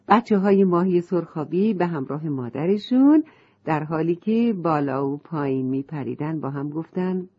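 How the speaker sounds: usual speaking voice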